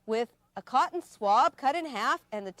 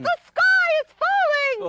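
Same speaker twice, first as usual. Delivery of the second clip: much higher yelled chipmunk cry; squeaky yell; 9 dB louder than usual. Acted. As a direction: shriek the warning to the woods. Chipmunk yells.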